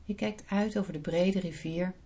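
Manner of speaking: soft, unhurried meditation voice; guided meditation. Acted. Leads a guided visualisation.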